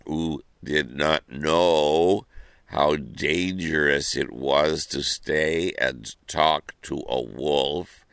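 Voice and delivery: plain reading voice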